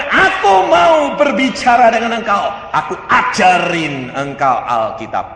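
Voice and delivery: high, loud divine declaration easing off; booming proclamation. Acted. God promises to teach the believer, loud proclamation. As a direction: proclaim it loudly, then come down. Acting God speaking to him.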